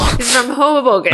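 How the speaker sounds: hammed-up disbelief, pushing it out; mock exasperated quote. Mocking Steve's fake hometown, loud incredulous retort. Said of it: "'cause he's like".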